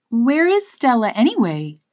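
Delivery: put-on high, light girl's voice; curious little girl asking; young girl voice.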